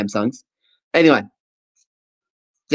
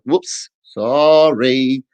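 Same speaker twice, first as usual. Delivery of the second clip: drawn-out sorry; 3 dB louder, much slower than usual. Retold or imitated. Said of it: "It's like".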